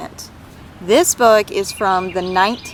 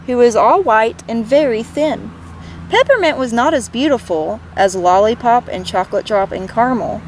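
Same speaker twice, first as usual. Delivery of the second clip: put-on gentle read-aloud for children; lively picture-book reading; story narration; 3 semitones higher than usual. A narrator's reading. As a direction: tell it like bedtime storytime.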